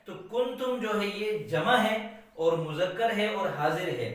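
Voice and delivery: normal talking voice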